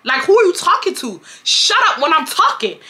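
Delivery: high, indignant rant; retold angry outburst. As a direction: tell her off in rising outrage. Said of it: "I really got mad".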